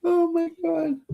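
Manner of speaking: high-pitched oh my God; exasperated surprise. Real, not acted. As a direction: squeal it in disbelief. Dropped pass, exasperated reaction.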